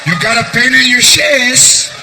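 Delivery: slow, drawn-out taunt; sarcastic mock sympathy. Wife throws sick husband's words back, slowly mocking. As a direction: stretch it out to mock his complaint.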